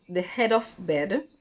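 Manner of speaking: ordinary delivery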